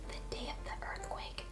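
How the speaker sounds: hushed, breathy storyteller whisper; whispered setup for the big reveal; dramatic whisper. Acted. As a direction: drop to a whisper to build suspense. Dramatic hush before earthquake reveal.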